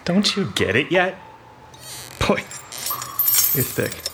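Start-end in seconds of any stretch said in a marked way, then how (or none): 2.3-4.0 slow whispered sneer; contemptuous whisper. Acted. Dawson sneers at the Boss in a slow whisper. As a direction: breathe the insult slowly.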